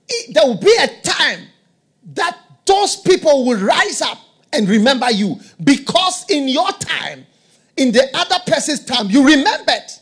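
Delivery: rising preaching fervor. Real, not acted. Preacher rousing congregation, loud fervent build. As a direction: build the crowd up line by line.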